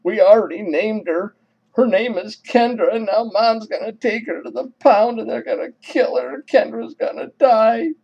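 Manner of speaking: high-pitched mock wail of a girl; imitated crying. Sister begging as Mom threatens the pound, imitated crying. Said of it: "Now her sister begins to cry".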